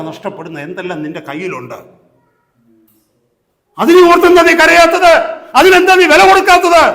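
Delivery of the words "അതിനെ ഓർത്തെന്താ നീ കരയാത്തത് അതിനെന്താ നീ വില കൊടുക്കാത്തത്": very loud and high; preacher's shouted challenge. Real, not acted. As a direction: cry out the question at full force. Sudden shouted challenge to the congregation.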